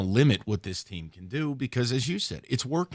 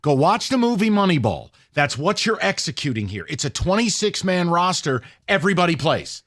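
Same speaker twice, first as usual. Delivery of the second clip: loud, higher-pitched insistence; emphatic rant; 5 semitones higher, 5 dB louder than usual. Real, not acted. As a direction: drive the argument home at raised volume. Heated rant defending the team.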